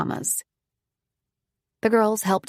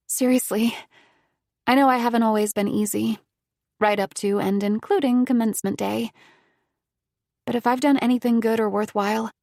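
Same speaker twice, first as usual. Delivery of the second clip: lightly voiced grateful young woman, a bit higher; young Nikki; 3 semitones higher than usual. A narrator's reading. Nikki thanking Miss Carmela, lightly voiced and sincere. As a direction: let her thanks come out earnest and quick.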